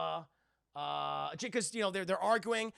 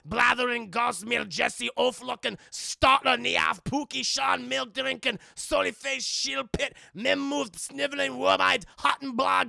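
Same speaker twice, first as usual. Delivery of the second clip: loud, raised Scottish tirade of insults; ranting Scotsman; 4 semitones higher, 9 dB louder than usual. Retold or imitated. Scotsman reels off insults at Jack, ranting. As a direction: hurl each insult as a blustering Scot. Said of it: "let me try to replicate".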